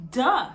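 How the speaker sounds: "Duh" as a short, voiced d-sound; letter sound demo. Shown as a demonstration. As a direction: say "Duh" crisply, one clipped beat. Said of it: "is the sound that D makes".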